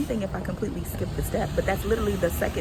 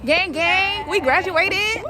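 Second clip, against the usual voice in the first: high, loud celebration; celebrating graduation with friends; excited cheer; 12 semitones higher, 9 dB louder, much slower than usual. Real, not acted. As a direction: cheer it out to your crew.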